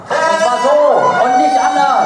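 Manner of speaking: loud, slow, high demonstration scream; demo scream. Shown as a demonstration. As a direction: show the parrot how to scream, loud. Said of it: "Sydney, schrei mal". (laut vorgemachter Schrei)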